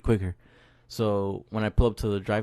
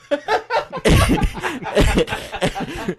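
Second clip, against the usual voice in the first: long high wheezing laugh; helpless high-pitched laughing fit; burst of laughter; 3 dB louder than usual. Real, not acted. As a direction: crack up mid-story, can't hold it. Cracks up telling own story.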